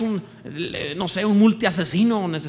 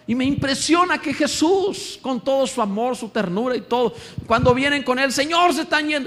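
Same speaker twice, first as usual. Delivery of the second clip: higher, louder preaching; impassioned preaching; 9 semitones higher, 3 dB louder than usual. Real, not acted. Preacher urging listeners about Christ's stability, high and loud. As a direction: lift it to a passionate climax.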